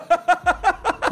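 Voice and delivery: short, high burst of laughter; high cackle. Real, not acted. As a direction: cackle helplessly at the blunder. Laughing at the accidental kill.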